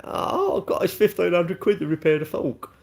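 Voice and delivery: high, whiny mock complaint; hammed-up squeal of dismay; moaning bike owner. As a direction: send up a bike owner fussing over the repair bill. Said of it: "imagine one of the folks going".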